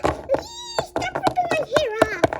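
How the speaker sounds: high, loud squealed plea; pained protest. Pretend. Child begging attacker to release hair, squealing. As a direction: squeal it out as if being hurt. Hair being pulled in pretend fight.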